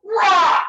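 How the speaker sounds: loud, high warrior yell; mock battle roar. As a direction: roar it like a charging hero. Imitates Zeus's battle cry.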